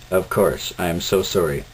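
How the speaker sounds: usual speaking voice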